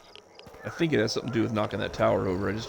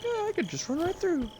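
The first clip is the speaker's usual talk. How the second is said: dumb self voice. Retold or imitated. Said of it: "I was like".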